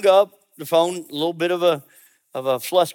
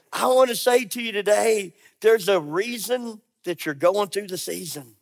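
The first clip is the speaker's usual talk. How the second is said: ordinary talk, nothing marked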